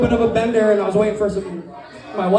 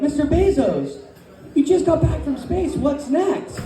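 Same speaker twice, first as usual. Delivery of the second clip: lightly voiced, higher-pitched reporter; eager interviewer; 6 semitones higher, slower than usual. Interviewer greeting Bezos back from space, eagerly higher.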